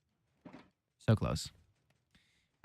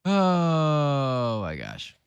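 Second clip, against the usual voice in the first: stunned; 3 semitones higher, much slower than usual. Real, not acted. Stunned reaction, drawn out.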